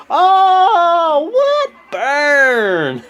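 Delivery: very high, loud squeal; excited shout. Real, not acted. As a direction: shriek at the burn. Reacting to the taunt.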